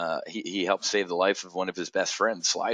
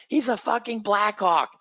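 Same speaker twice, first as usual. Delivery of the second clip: very high-pitched imitation of an excited fan; breathlessly impressed, insistent mock freak-out; 11 semitones higher than usual. Wife explaining who the hockey star is, freaking out. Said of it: "And she's freaking out".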